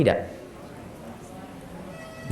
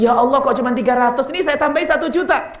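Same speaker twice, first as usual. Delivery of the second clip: higher, bright-eyed generous surprise; delighted husband; 7 semitones higher than usual. Acted. Husband answers his wife's request, higher and delighted. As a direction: gush like a husband eager to give more.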